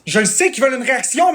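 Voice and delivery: usual speaking voice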